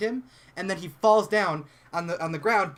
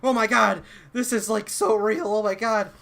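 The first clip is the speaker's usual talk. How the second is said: higher, louder starstruck gasping; excited gushing; 5 semitones higher, 3 dB louder than usual. Retold or imitated. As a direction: gush like a fan watching a slasher. Characters gush while watching a movie.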